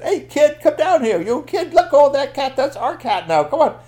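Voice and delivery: high, loud squawking orders; strained storybook crowd shouting; angry watchers. Acted. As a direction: yell up demands at an escaping kid. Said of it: "all the park watchers said".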